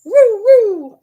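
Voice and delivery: high, loud howling woo; dog howl. Retold or imitated. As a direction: howl like the old dog yelling at the pack. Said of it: "she goes".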